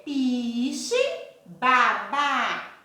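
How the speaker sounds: PI-CHE, BA-BÁ, slow and drawn out; syllable by syllable. Shown as a demonstration. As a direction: stretch both syllables of piche and babá. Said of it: "Tem quantas sílabas?".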